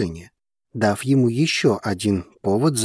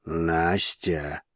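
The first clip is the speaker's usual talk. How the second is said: low, soft warning; stern murmur; 6 semitones lower, 5 dB quieter than usual. A narrator's reading. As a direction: breathe the name low, as a warning. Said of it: "сурово прошептал Вулкан". (суровый тихий оклик низким голосом)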